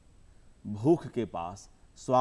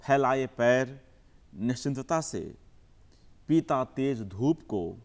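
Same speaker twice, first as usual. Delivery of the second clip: measured recital with long pauses between phrases; poem recitation; 5 dB louder than usual. Acted. Poet reciting his poem to the audience, measured and unhurried. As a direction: savour each image, let the lines breathe.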